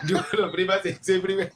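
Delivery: laughing. Real, not acted. Laughs at host's claim.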